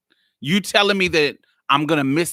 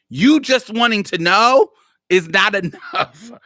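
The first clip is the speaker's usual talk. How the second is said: louder, higher retelling of the retort; 5 semitones higher, 4 dB louder than usual. Retold or imitated. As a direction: press the retort harder and higher. Repeats her retort twice.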